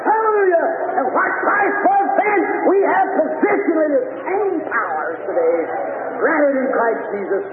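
high-pitched hallelujah cry; exultant preaching. Real, not acted. Preacher proclaiming Christ's power to the church, exultant preaching. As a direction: burst out high in praise, then press on.

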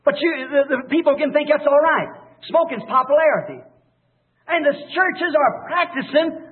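usual speaking voice

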